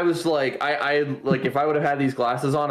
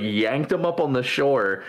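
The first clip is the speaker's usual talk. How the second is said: usual speaking voice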